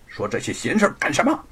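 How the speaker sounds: loud, high cut-in, then settling; impatient. Acted. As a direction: wave away the bickering impatiently. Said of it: "不耐烦地打断了他们". (不耐烦地打断)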